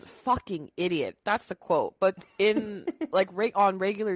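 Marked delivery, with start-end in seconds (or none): 0.1-1.2 lightly voiced wife's dry put-down; a hint of Lois, flat and fed up; Lois's put-down. Quoting Lois calling Peter an idiot, lightly voiced. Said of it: "it's just the way she says it".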